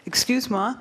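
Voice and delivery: usual speaking voice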